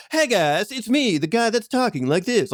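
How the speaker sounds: high, bright musical theater delivery; high stagey South Park side character. Shown as a demonstration. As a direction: belt it like a showy stage character. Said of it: "Just do the musical theater voice".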